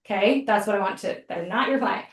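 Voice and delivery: ordinary talk, nothing marked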